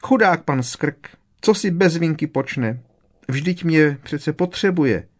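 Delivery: high, plaintive elf lament; high-pitched sorrowful creature voice; tearful moaning. A narrator's reading. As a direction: bewail the poor master, near tears. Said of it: "po tváři se jí znovu řinuly slzy".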